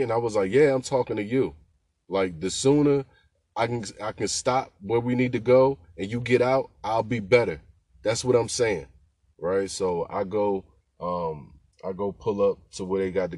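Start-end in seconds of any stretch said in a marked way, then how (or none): 0.5-8.9 loud, annoyed retort; angry comeback. Retold or imitated. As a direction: put her in her place, loud and fed up. Driver snaps back at rude passenger.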